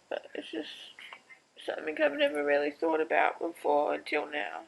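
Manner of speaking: real tears, voice wavering on the words; fighting back crying; tearful. Real, not acted. Mum tearful about her mother leaving, real crying. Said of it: "I need to stop crying".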